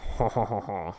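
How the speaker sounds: hammed-up French "hon hon hon" laugh; mock French chuckle. French chef pleased with his live introduction, mock chuckle. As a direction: chortle like a cartoon Frenchman.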